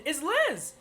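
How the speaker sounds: excited shout. Real, not acted. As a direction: call out the raider's name with delight. Surprised by a raid.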